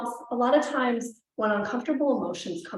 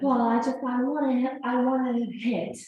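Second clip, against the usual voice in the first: a hint of a little girl, pitched higher; lightly voiced four-year-old; 4 semitones higher than usual. Four-year-old Ani telling mom what she wants, lightly childlike, higher. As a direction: lightly play the small daughter blurting it out. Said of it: "she's like".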